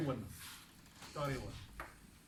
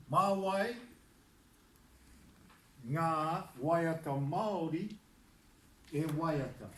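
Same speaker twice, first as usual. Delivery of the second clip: slow model sentence; slower than usual. Shown as a demonstration. Teacher models the question sentence for the class, slowly. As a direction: spell it out slowly for students. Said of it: "the question".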